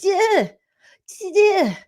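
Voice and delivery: short high vocal blurts; two high jolts of a short-circuiting brain; brain glitch noise. Retold or imitated. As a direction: imitate a brain stalling in high bursts. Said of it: "my brain's like".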